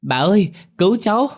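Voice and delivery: high, loud cry for help to grandma; a boy shrieking for grandma to save him; child crying out. A narrator's reading. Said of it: "hét lớn gọi bà". (cậu bé hét to cầu cứu bà nội)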